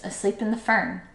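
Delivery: plain reading voice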